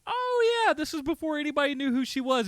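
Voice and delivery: a mock realization that starts very high and settles; a light oh-yeah of spotting someone; surprised recognition. Host retelling how he recognized the actress, a high, light realization. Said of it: "I was just like".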